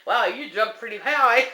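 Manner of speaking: high, mocking ghostly giggle. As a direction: tease her with impish giggling. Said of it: "they're off in the corner going".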